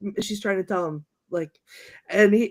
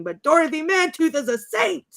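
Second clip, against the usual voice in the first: pitched-up indignant quote; mock outburst; 7 semitones higher than usual. Fan retelling Champ defending someone's mother, mock-indignant. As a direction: protest it higher, rising on SAINT. Acted indignant movie line.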